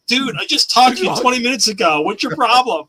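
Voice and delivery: high, loud comic indignation; mock exasperation. Cosplayer to fans who failed to recognize him, acted exasperation. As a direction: call them out in playful disbelief. Acts out exchange with unrecognizing fan.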